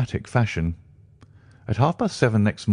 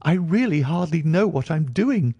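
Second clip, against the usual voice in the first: high, flustered doctor; distraught cry; 9 semitones higher than usual. A narrator's reading. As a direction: cry out, beside himself with shock. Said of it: "he cried".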